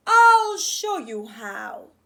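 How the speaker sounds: hammed-up father declaring; dad's voice. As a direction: announce it grandly as dad. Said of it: "his dad said".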